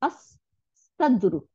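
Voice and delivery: "আস-সাদরু" very slowly with a long S; slow model of "আস-সাদরু", S doubled; doubled Sad. Shown as a demonstration. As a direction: hold the S in "আস-সাদরু", no L sound. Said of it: "লামের আর উচ্চারণ নাই".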